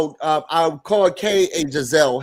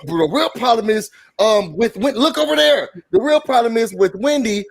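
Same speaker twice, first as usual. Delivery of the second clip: raised-pitch confrontational retelling; acted arguing; 6 semitones higher than usual. Host voices Kay stirring up an argument, animated accusation. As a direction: play up the accusation, higher voice.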